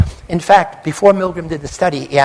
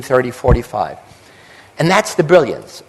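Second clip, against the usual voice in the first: ordinary talk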